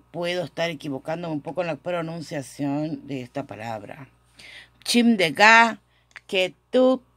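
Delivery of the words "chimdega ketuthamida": higher and louder, emphatic; firm model phrase. Shown as a demonstration. Teacher proclaims the corrected phrase to class, louder. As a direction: announce Chimdega ketuthamida clearly, higher. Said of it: "equivocándome en esta pronunciación".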